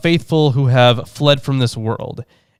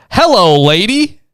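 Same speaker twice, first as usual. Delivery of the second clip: high-pitched, louder acted hello; acted greeting; 8 semitones higher, 6 dB louder, slower than usual. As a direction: greet the lady in a raised voice. Voices the narrator's greeting back.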